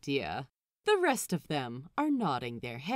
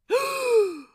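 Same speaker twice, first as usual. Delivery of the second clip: sharp, high, loud gasp; scandalized gasp; 9 dB louder than usual. A narrator's reading. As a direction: gasp in shock. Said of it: "A scandalized gasp escapes Roach".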